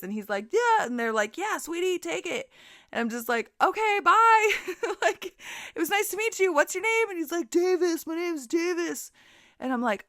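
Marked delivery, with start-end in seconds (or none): 0.5-2.5 higher voices urging her; lightly voiced friends. His friends urging her to take the chips, higher coaxing voices. 3.6-7.1 higher, bright and quick exit; chirpy goodbye. Retold or imitated. To drunk Davis while leaving with the chips, a high chirpy goodbye. 7.5-9.1 higher put-on voice, slow; drunk Davis. Drunk Davis telling her his name in a higher slow voice.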